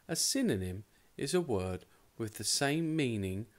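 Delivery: ordinary delivery, nothing marked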